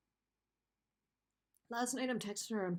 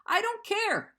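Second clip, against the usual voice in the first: much higher, louder, almost shouting; the repeat cracks up into a near-cry; real upset, rising; 10 semitones higher, 4 dB louder, slower than usual. Real, not acted. Venting to viewers about the ex, near-shouted repeat. Breaking down over ex.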